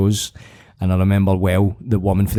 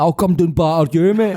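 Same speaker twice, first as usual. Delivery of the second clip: mock threat; 5 semitones higher, 4 dB louder than usual. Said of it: "He's like".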